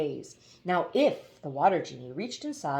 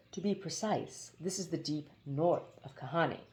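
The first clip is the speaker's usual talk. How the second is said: quiet genie; 7 dB quieter than usual. Acted. Genie explains, hushed.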